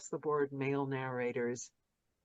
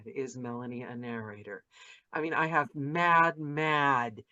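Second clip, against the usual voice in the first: normal talking voice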